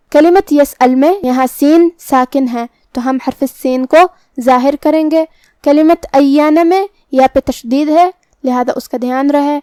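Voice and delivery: ordinary talk, nothing marked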